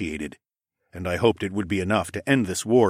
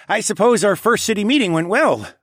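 laughing; 13 semitones higher, 6 dB louder than usual. A narrator's reading. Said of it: "Tawa laughed".

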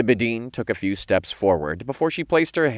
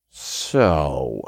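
drawn-out, lower opener; somewhat snarky; 5 semitones lower than usual. A narrator's reading. Hero questions the offered deal, snarky. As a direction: stretch it with mild snark. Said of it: "somewhat snarkily".

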